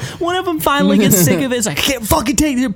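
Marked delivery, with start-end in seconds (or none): 1.8-2.8 fed-up relative. Pretend. Relative fed up, pulls plug.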